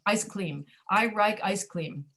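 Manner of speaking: "like" becomes "rike", "cream" becomes "cleam"; L and R swapped. Shown as a demonstration. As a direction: slowly demonstrate "cream" with L for R and "like" with R for L. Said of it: "there's no difference between those two sounds".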